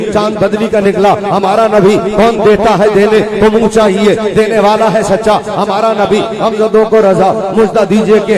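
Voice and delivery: loud, rhythmic declaimed naat; fervent verse recitation. Real, not acted. Preacher reciting praise of the Prophet, loud rhythmic declamation. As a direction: drive each line home to its refrain.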